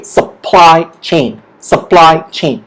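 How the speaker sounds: slow "supply chain", PLY stronger, each word falling; stress on PLY. Shown as a demonstration. Teacher leading say-it-with-me practice, stress on PLY in supply chain. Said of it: "that means it's stronger there".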